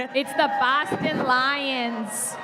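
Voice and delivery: put-on slow, drawn-out hammy Boston accent; fake Boston accent. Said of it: "my terrible fake Boston accent".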